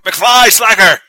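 put-on slow, loud cranky old man; old man yelling. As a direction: bark it like an old principal. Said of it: "You see him in the nursing home?".

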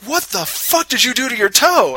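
high, loud, alarmed cry; shocked outburst. Retold or imitated. Husband seeing his wife's bloody toe, shocked high yell. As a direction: blurt it out in horror at the toe.

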